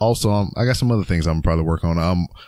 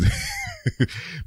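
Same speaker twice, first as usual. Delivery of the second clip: laugh; 6 dB quieter than usual. Real, not acted. Laughs after movie voice remark.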